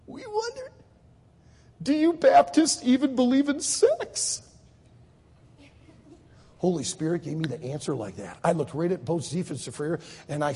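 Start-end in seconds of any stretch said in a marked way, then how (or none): 0.1-4.4 high, slow lead-up to an awkward question; incredulous question. Retold or imitated. Israeli asking Jim an awkward question, hammed-up incredulity. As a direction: start small and high, then spell it out in disbelief.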